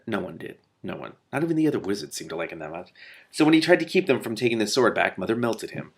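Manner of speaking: ordinary delivery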